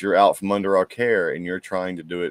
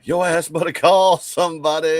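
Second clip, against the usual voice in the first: raised, emphatic comeback; loud; 8 semitones higher, 7 dB louder than usual. Real, not acted. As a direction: punch it out loud.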